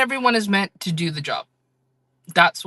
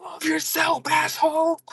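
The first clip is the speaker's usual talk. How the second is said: much higher, mock yell of love yourself; aggressive shout; 12 semitones higher, slower than usual. Streamer showing her aggressive kindness to a viewer, high mock yell. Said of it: "I'll be like".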